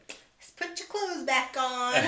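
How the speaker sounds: mock nurse. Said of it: "I just remember him going".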